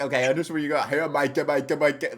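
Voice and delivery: mock British accent, hammed up. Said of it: "it was like".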